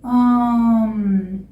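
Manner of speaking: ordinary talk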